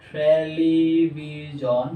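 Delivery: slow television, syllables held apart. Shown as a demonstration. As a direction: draw television out for learners. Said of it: "চারবার উচ্চারণ করা হয়". (Television ধীরে ভেঙে ভেঙে)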